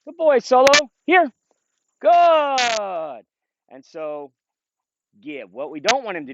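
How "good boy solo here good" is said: high, loud, bright-eyed dog praise; excited high praise. Real, not acted. Trainer calling his dog Solo in, high excited praise. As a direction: cheer the dog on as he comes back.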